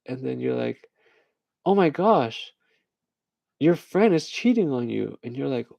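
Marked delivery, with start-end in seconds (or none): 1.6-5.2 high-pitched, scandalized movie line; mock shocked gossip. Character revealing a cheating friend, mock shock. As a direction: break the news with hammed-up alarm.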